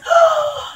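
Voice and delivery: sharp high gasp. Acted. The king gasps at his stung nose, high and sharp. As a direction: gasp in shock.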